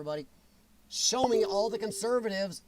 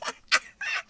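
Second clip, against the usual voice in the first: high laugh. Real, not acted. Reacting to chat on stream.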